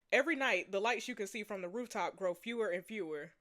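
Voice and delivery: low, soft narrating voice; story narration. A narrator's reading. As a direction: narrate it quietly and sombrely.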